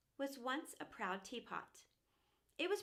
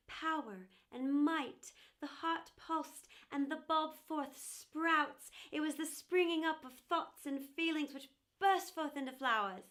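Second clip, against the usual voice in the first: loud, emphatic, stretched-out words; swelling exultation; 3 semitones higher, 6 dB louder than usual. Acted. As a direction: build with wonder as life bursts forth. Bulb bursts into life.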